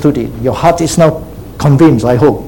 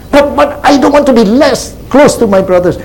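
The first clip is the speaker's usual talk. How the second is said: high-pitched, stammered objection; protesting congregant; 9 semitones higher than usual. Acted. Worried believer objecting to the preacher about his brothers, high-pitched protest. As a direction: plead the objection in a raised pitch.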